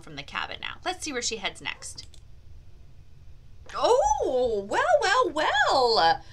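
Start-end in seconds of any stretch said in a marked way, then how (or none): 3.7-6.2 high, louder drawn out; mock-dramatic well well. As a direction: play up the surprise.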